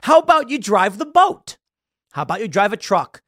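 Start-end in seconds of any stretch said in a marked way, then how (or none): 0.0-1.6 high, loud dismissive retort; scoffing retort. Real, not acted. Host scoffing at the young man, high and loud. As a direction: shoot it down loudly, then suggest another job.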